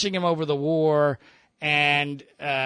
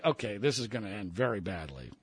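inner thought; 8 semitones lower, 10 dB quieter than usual. Retold or imitated. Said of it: "I'm immediately knowing".

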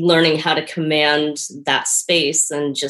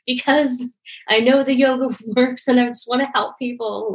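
higher, mock tearful pleading with a laugh; half-laughing, half-crying, imitating her younger self; retold tearful plea; 7 semitones higher than usual. New teacher on the phone to her mom, tearful. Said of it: "like, tears and crying".

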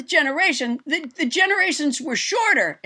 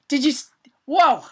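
ordinary talk

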